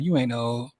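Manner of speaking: ordinary delivery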